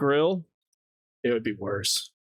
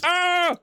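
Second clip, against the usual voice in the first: mock alarm yelp; 14 semitones higher, 3 dB louder than usual. Imitating redeem alarm.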